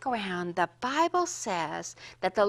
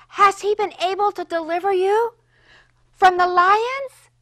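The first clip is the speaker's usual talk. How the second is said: loud, high anguished calling out; a grieving king crying out for Daniel; lamenting cry; 7 semitones higher, 10 dB louder than usual. Acted. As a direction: call down into the pit in pain. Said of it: "cried out with a lamenting voice, oh, with much pain in his voice".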